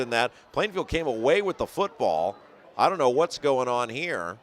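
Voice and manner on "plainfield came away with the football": usual speaking voice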